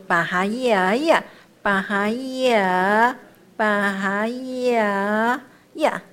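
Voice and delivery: slow 巴哈呀呀 with the first 呀 held long; turning sound. Shown as a demonstration. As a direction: stretch 呀, then turn into a short final 呀. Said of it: "就转音了呀".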